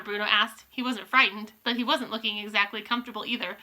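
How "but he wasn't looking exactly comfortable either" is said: ordinary delivery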